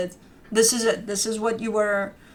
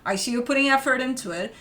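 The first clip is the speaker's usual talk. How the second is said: ordinary talk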